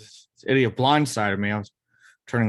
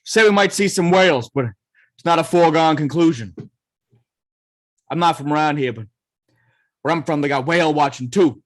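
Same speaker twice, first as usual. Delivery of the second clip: put-on loud, higher thick Boston accent; 3 semitones higher, 5 dB louder than usual. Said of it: "Nick talks in a thick Boston accent".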